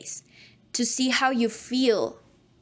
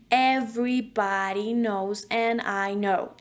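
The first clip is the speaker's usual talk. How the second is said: slow, vowel emphasis; slower than usual. Shown as a demonstration. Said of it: "emphasis on the vowels".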